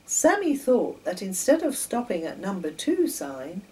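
plain reading voice

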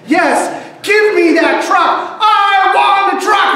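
put-on high, loud whiny little-boy shout; child tantrum. Boy shouting at mom in the toy aisle, high loud whine. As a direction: demand the truck like a spoiled toddler.

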